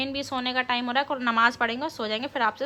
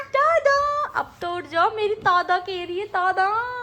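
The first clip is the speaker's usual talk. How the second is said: high, slow cooing to a little daughter; 10 semitones higher, 3 dB louder than usual. Real, not acted. Mother cooing sweetly to her baby daughter, high baby talk.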